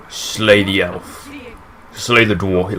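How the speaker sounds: slow, menacing quote of a bigot; evil villain voice. Retold or imitated. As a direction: drawl it out like a villain. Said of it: "that clip says".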